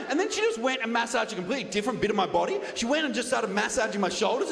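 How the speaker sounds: ordinary talk, nothing marked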